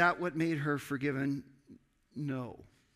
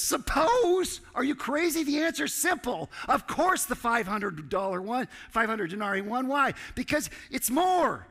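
much higher and louder, an exasperated rebuke; incredulous shout; 9 semitones higher, 5 dB louder than usual. Acted. Jesus rebukes Simon over the debt question, loud incredulous shout. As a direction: shout it like you can't believe he doubts it.

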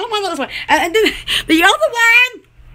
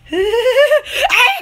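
high breathless giggle; real giggles; giggling fit; 6 semitones higher than usual. Real, not acted. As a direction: burst into giggles. Laughing fit.

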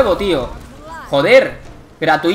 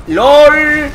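shouted lol; 8 semitones higher, 8 dB louder than usual. Real, not acted. Reacting to a game moment.

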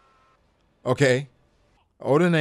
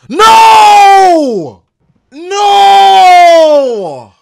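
anguished shout; 13 dB louder than usual. Real, not acted. Reacting to a betrayal.

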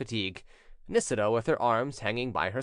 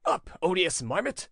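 higher-pitched rousing call; mildly raised cry to wake someone; 6 semitones higher than usual. A narrator's reading. Prince waking his servant roughly, a rousing cry. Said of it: "shaking him roughly, cried in his ear".

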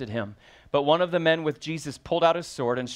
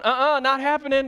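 much higher, louder "Uh-uh, not happening"; high indignant refusal; 12 semitones higher, 6 dB louder than usual. Retold or imitated. A preacher mimics the angry disciple, high loud indignant refusal. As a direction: refuse like you're already reaching for the sword. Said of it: "We'd be the one going".

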